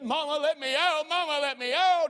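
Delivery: put-on high, loud, desperate child's cry; child screaming. Little girl screaming from the locked closet to her mama. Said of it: "and she screamed".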